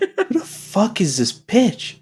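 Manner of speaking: high-pitched, hammed-up outrage; mock indignation. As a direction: act appalled and baffled by a stranger. Playing bewildered reaction to Scottish actress.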